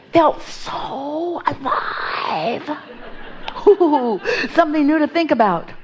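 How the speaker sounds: high, bright thrill at a new puzzle; gleefully lit up by something new; excited. Acted. Esther thrilled by the stuck van, excited. Excited about fresh problem.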